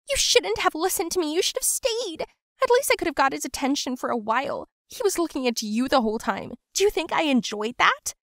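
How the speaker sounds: hysterical blaming. Acted. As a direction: lash out through hurt. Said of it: "she got hysterical".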